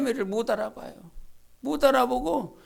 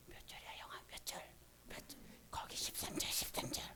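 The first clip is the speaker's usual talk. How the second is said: 예배 중 들리지 않게 속삭임; 옆 아이에게 몰래 묻듯 속삭여; whisper; 24 dB quieter than usual. Retold or imitated. 예배 중 아이와 속삭이는 대화. (예배 중 옆자리 아이에게 몰래 묻는, 속삭임)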